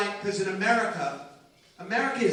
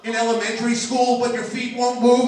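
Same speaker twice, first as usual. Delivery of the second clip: normal talking voice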